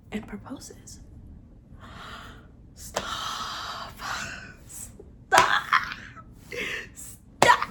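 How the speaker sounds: whispered gasping stop; breathless whisper. Real, not acted. Fan swooning over the proposal, whispered gasps. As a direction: gasp it, overwhelmed with swoon.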